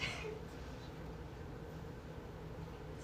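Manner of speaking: long, soft, voiceless sob; crying. Real, not acted. Calling friends here her family, quietly crying. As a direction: weep quietly, trying to stop. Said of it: "I said I wasn't gonna cry".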